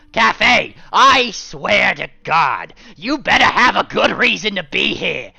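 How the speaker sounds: high, loud, slow seething yell; husband warning his wife; angry shout. Acted. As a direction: bellow through frustration.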